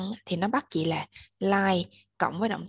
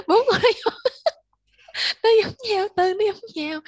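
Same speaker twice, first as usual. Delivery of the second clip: real helpless laughter, pitch way up; laughing; 14 semitones higher, 8 dB louder than usual. Real, not acted. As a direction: laugh hard through the words, loud and high. Bật cười giữa lúc giảng bài. (vừa nói vừa cười lớn, giọng cao vút)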